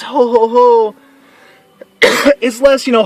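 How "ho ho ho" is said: big slow Santa laugh "ho ho ho", louder; booming jolly Santa laughing for the kids. Shown as a demonstration. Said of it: "the laugh I do for Santa Claus".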